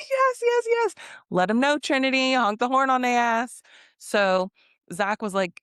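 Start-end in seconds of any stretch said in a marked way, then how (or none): 0.0-3.6 gleeful egging-on, high and laughing; excited cheering. Retold or imitated. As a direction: hype her up like a cheering friend.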